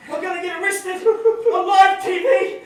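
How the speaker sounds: loud laughing yell of alarm; laughing in a mock panic; panicked laughing shout.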